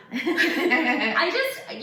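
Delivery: gasp, then laugh it out high; a breathless laughing gasp. Retold or imitated. Retelling her shock at his promise, laughing gasp. Reacting to boyfriend's commitment.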